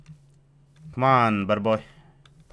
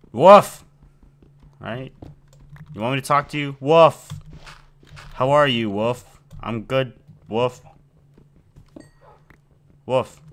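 dog barks; 4 semitones higher, 9 dB louder than usual. Pretend. Talking as the dog.

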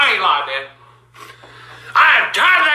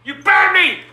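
long, drawn-out angry shout; real outraged bellowing; outraged yell; much slower than usual. Real, not acted. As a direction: yell it in furious protest. Yelling accusation in the quarrel.

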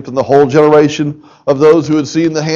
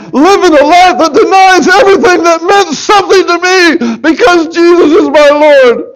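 emotional, near tears; 14 semitones higher, 5 dB louder than usual. Real, not acted. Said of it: "I'm emotional about this today".

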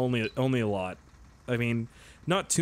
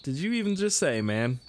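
slow, high, confused disbelief; mock bewildered question; baffled; 7 semitones higher, slower than usual. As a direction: drag it out like you can't believe your ears. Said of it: "And I was like".

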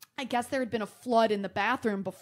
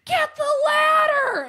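very high, slow shout from downstairs; mom shouting; 9 dB louder, slower than usual. Retold or imitated. Mom downstairs yelling to daughter, long high shout. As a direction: yell up the stairs, drawn out. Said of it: "she's like".

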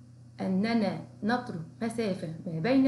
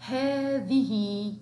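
slow teacher modelling; slow drawn-out model word; 4 dB louder, much slower than usual. Shown as a demonstration. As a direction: stretch each sound slowly. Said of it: "هيا معا".

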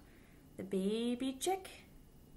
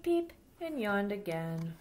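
put-on lively storybook voice for little kids; reading to children; 3 semitones higher than usual. A narrator's reading. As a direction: read it aloud like storytime for children. Reads picture book to children.

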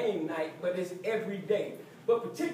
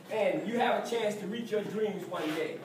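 usual speaking voice

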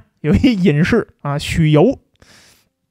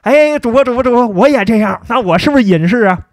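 high, louder eager fan calling the host; excited viewer voice; 5 semitones higher, 4 dB louder than usual. Retold or imitated. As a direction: butt in like an overeager viewer. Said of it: "哎，有人说了".

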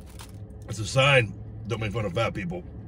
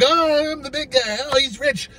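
high mocking imitation of staff; lightly mock their thinking; 12 semitones higher than usual. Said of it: "And they think".

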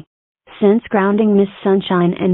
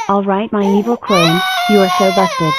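ordinary talk

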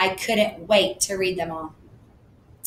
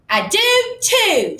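put-on high, loud, slow childish yell; 14 semitones higher, 5 dB louder, much slower than usual. A narrator's reading. Susie insisting she has a brother, high slow yell. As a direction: shout it like a stubborn kid. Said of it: "she yelled".

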